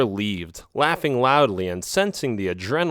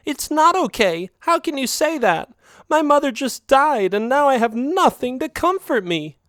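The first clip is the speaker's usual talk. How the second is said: yelling. A narrator's reading. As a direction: yell at him through grief. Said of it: "She looked at me and yelled".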